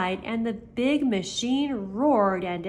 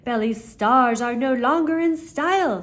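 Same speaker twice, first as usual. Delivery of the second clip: sly salesman; 3 semitones higher, 6 dB louder than usual. A narrator's reading. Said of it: "said McBean".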